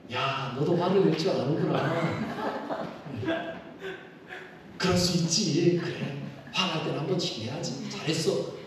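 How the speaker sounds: a lightly voiced, tender God comforting Moses; gentle pretend God. (다정하게 달래는 하나님 목소리)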